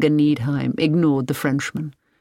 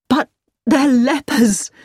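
high, loud, drawn-out horror; horrified exclamation; 7 semitones higher, 5 dB louder, slower than usual. A narrator's reading. Hilary sees the people behind the fence, horrified. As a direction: recoil in shock as you say it. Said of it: "Hilary gasped in horror".

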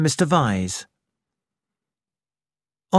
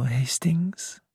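soft dry whisper; whispered teasing; 2 semitones higher, 6 dB quieter than usual. Acted. Poirot teasing Hastings with a whisper. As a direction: drop to a sly whisper.

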